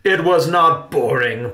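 loud, high, emphatic denial; forceful theatrical objection; indignant protest. A narrator's reading. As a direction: protest like an offended actor. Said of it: "protested Uncle Riley".

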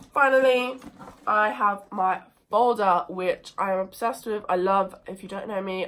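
ordinary talk, nothing marked

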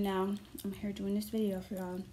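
speaking between sips; words around drinking straw; talking with straw at lips. Real, not acted. Seen 1: Straw held at her lips as she talks, between sips.

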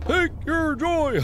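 slow, high, drawn-out intoning; mock the propaganda line slowly; hammed-up eerie chant; creepy slogan. Repeating the eerie game slogan.

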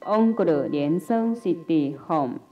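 usual speaking voice

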